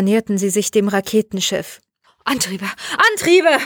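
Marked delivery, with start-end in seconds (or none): 2.2-2.7 restrained first alarm. A narrator's reading. Vitali first grasps they need thrusters, restrained alarm. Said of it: "schrie Vitali auf". 3.1-3.6 high, sudden cry; alarmed shout. A narrator's reading. Vitali cries out for the thrusters, alarmed shout. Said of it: "schrie Vitali auf".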